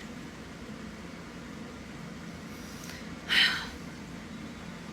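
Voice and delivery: a long exhausted exhale; long weary sigh. Real, not acted. Done ranting to viewers, a long weary sigh. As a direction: let all the air out, worn down.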